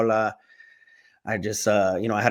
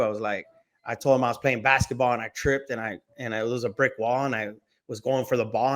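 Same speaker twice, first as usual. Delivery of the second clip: usual speaking voice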